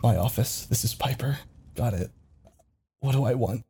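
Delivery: soft thinking aloud; quietly processing; muttering to self. Real, not acted. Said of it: "this is all in real time".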